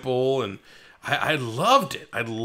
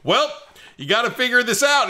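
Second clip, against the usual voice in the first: high, quick, excited retelling; imitating someone bursting with news; all excited; 11 semitones higher, 4 dB louder than usual. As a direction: blurt it out in mock excitement. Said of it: "He's, like, all excited".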